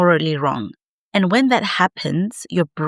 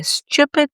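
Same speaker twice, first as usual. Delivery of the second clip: Stupid thrown up high with scorn; underscoring the word Stupid; emphatic stress; 11 semitones higher than usual. Real, not acted. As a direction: hit "Stupid" hard and high. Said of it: "can I underscore".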